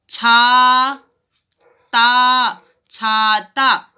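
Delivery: छाता loud, slow, with long aa. Shown as a demonstration. Teacher to children, छाता with stretched aa. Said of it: "आ का sound सुनाई दे रहा है ना".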